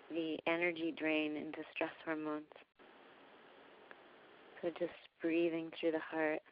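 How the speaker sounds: calm, unhurried meditation voice with long pauses; guided meditation. Real, not acted. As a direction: gently guide listeners into breathing, softening as you go. Leads a heart-breathing meditation.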